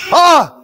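sudden loud high yelp of fright; startled shout. Real, not acted. Ghost hunter hears a voice answer, startled shout. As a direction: jolt out a shocked cry.

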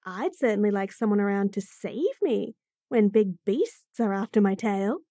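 usual speaking voice

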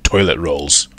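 plain reading voice

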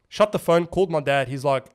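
normal talking voice

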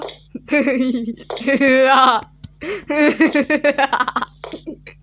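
loud frightened laughter; hysterical laugh. Real, not acted. Player laughing hysterically after the scare. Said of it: "Salak gibi gülüyorum".

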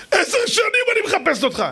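high, tearful cry of joy. Retold or imitated. The rescued girl weeps to the groom who once saved her, crying. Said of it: "התחילה לבכות".

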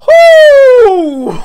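shrieked high whoo of shock; high startled whoo. Real, not acted. Jump scare in horror game.

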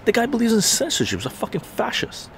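retelling the shout at his friend, heated; heated retort. Retold or imitated. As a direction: tear into the guy. Retold heated argument.

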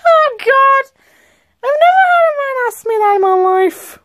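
high, flustered exclamation; flustered outburst. Acted. Asked for permission at bedtime, high flustered outburst. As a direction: exclaim it high, overcome by the question. Flustered reaction to intimate question.

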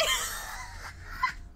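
squealing high laugh; high laughter. Real, not acted. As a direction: laugh helplessly. Continued laughter at the face.

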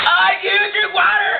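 high, loud, tearful shout; wailing in real distress; crying out. Real, not acted. Mouth burning from cinnamon.

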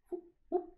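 soft, high-pitched whoop noises; imitated whoop. Narrator adds sound effects to the story, soft high whoops.

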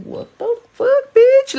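high-pitched, indignant squeal; appalled disbelief. Retold or imitated. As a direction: act out shock, voice leaping way up. Retold shock at his request.

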